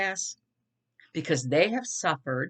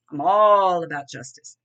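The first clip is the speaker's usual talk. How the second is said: punched out, much louder; louder insistent restatement; louder emphatic repeat; 8 dB louder than usual. Shown as a demonstration. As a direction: hammer it home with force.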